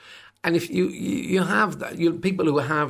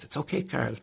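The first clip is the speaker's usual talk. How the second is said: soft, understanding friend voice; friend's soothing reply; 9 dB quieter than usual. Retold or imitated. Friend on the phone comforts Carl, softly understanding. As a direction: reassure him gently, a touch quieter. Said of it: "she goes".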